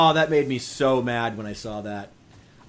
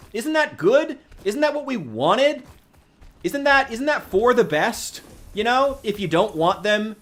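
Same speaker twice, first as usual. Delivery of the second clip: sarcastic; 9 semitones higher than usual. Acted. Sarcastic mock cheer about funding.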